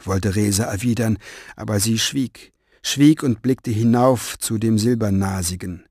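plain reading voice

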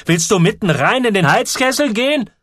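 alarmed shout. A narrator's reading. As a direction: yank him back with your voice. Man pulls boy from boiler. (laut und erschrocken)

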